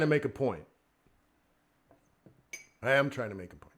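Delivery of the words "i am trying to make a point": low, soft restatement; toned-down, lower voice; not yelling. Real, not acted. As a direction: repeat the point with restraint, quietly. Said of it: "I'm not yelling".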